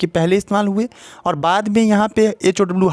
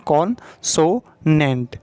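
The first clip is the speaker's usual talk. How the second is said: Consonant drawn out very slowly in three parts; teacher spelling out consonant in three slow beats; slow syllable demo; much slower than usual. Shown as a demonstration. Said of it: "तीन part में बोल रहे हैं".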